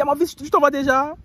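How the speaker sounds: high, loud mock auntie; hammed-up auntie; woman's voice. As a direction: ask sweetly as he leaves. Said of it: "Elle dit".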